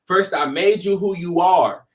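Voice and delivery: ordinary talk, nothing marked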